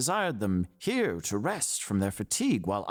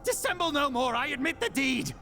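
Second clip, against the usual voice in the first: high, desperate confession; frantic shriek. A narrator's reading. Killer confesses to the officers, shrieking. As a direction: scream out the guilt. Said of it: "I shrieked".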